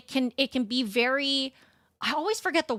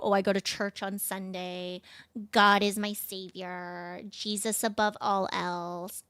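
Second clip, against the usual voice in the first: mock pious churchgoer; 3 semitones lower, 6 dB quieter than usual. Mocking churchgoers' pious talk.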